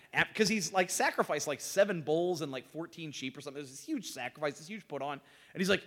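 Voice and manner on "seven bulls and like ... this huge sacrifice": ordinary delivery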